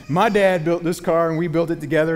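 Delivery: put-on loud, proud boy bragging about his dad; excited kid. Son boasting to everyone about the car, loud and excited. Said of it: "he was so excited".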